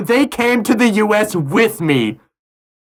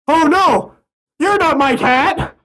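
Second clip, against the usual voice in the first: startled shout; 7 semitones higher, slower than usual. Retold or imitated. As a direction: yelp it out in mock shock. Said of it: "And I'm like".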